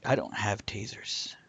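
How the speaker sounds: unvoiced whisper aside. Real, not acted. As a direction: whisper it under the breath. Aside after taser joke.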